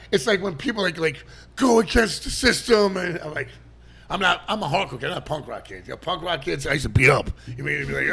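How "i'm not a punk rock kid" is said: ordinary talk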